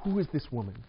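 a soft puzzled wonder at the wife in bed; bewildered hush. Retold or imitated. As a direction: murmur it like waking beside a stranger. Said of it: "you're like".

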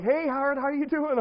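loud, high imitation of a friendly dad; hearty mock greeting. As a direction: greet with hammed-up, oblivious cheer. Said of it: "He's like".

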